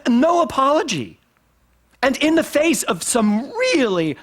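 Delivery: high, impassioned preaching; worked up, pushing out each phrase; emotional. Real, not acted. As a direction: let real emotion lift the voice high. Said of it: "I don't know why I'm getting emotional".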